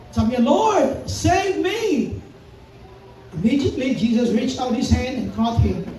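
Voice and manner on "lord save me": a high, pleading cry of a sinking man; Peter, sinking, crying out to his Lord; cry for help. Acted. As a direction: call out to be saved. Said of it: "cried out".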